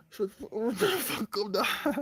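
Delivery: slow, laughing mock scold; playful laughing scold. Real, not acted. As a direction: laugh it out teasingly.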